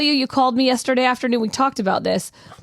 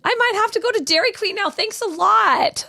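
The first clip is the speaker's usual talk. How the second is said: higher, playful mock complaint; teasingly aggrieved, pitched up; mock annoyance; 8 semitones higher than usual. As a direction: pretend to blame them, stretching the final words. Mock blaming co-host for craving.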